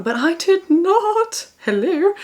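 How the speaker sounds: high, slow, teasing but I did not; playful high drawn-out denial; cheeky denial. Real, not acted. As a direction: tease it out slowly with a grin. Cheeky contour reveal.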